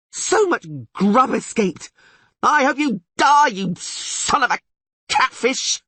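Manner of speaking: high, loud, furious cursing; frustrated bard cursing. Acted. Dandelion curses the lost catfish, high and loud. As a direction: curse the escaped fish.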